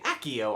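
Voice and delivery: "Accio" with both c's as one k; movie pronunciation. Shown as a demonstration. Showing how the movies say the spell, "Accio" with a hard k. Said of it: "and in the movies".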